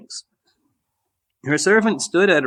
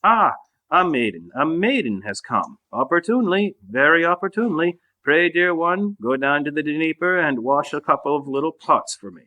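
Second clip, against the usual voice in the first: ordinary delivery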